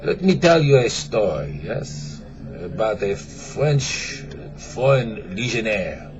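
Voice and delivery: put-on slow comic French accent. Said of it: "start over with an accent".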